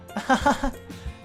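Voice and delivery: high, airy character giggle; acted giggle. Sayori's acted embarrassed laugh.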